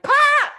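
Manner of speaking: high, loud exploding laugh; explosive laugh burst. Retold or imitated. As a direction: spit out a laugh you can't hold in. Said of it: "like, explosive".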